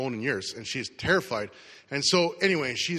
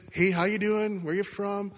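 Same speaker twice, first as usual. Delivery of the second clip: friendly small talk; 5 semitones higher than usual. Retold or imitated. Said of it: "So I start just, ... chatting".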